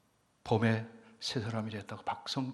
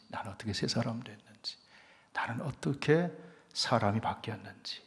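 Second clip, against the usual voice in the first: usual speaking voice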